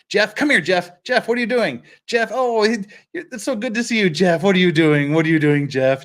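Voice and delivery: calling the dog. Retold or imitated. Streamers calling a Minecraft dog named Jeff, cooing pet voice. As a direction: coax the dog over in a sing-song pet voice. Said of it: "we're just like".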